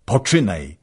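loud call to begin; shouted urging. A narrator's reading. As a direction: urge him on, shouting. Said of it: "krzyknął Wolodyjowski".

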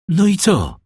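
loud, high, anxious question; urgent cry. A narrator's reading. Father and fiancée calling to the shipowner, urgently. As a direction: cry out for the news. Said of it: "Zawołali jednocześnie".

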